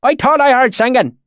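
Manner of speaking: high, loud thick rural dialect; thick valley accent. A narrator's reading. Swineherd questions strangers in thick valley accent. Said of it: "his accent so thick and oily".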